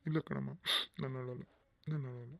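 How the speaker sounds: choked, quiet denial through tears; tearful father insisting he isn't crying. Acted. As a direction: hold back the sobs softly. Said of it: "ನೀವ್ ಯಾಕಮ್ಮ ಅಳ್ತಾ ಇದ್ದೀರಾ?".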